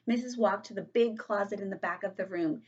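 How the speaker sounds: usual speaking voice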